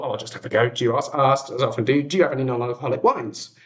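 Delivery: usual speaking voice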